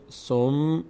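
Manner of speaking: som, resting on the m; doubled mim, first half. Shown as a demonstration. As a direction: say سم as one syllable closed on a held m. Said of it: "یک بار باید به همراه ما قلب خونده بشه".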